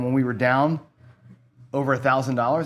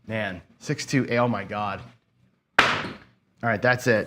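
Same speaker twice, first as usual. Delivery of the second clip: ordinary delivery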